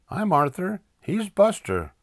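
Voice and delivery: put-on high kid voice; eager boy introduction. Arthur introducing himself to the driver, eager. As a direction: pipe up high with the names.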